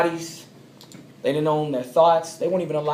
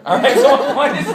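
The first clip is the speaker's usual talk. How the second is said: laughing; 9 semitones higher, 10 dB louder than usual. Real, not acted. Laughing after the joke.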